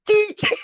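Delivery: high-pitched mock horn squeal; playful high toot; imitated train toot. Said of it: "Rick went".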